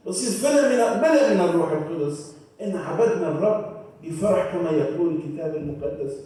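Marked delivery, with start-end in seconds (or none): none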